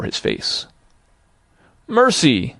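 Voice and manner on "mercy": high desperate plea; pleading cry. A narrator's reading. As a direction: beg for mercy in terror. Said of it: "Scrooge on his knees".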